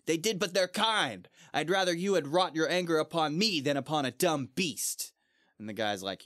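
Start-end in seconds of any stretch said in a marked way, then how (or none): 0.1-5.1 mock indignant knight. Gawain scolding the knight over his slain hounds, indignant. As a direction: pitch up in hurt outrage. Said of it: "And he's like".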